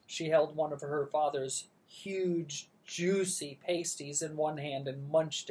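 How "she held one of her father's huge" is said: usual speaking voice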